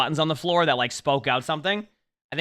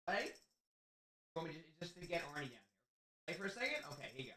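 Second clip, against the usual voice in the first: soft pet talk; 8 dB quieter than usual. Real, not acted. Talking softly to his dog.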